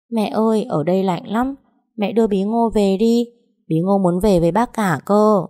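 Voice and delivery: put-on a homesick small child begging to go home; little girl voice. Little daughter begging mother to take her home.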